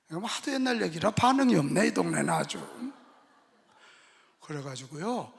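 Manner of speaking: ordinary delivery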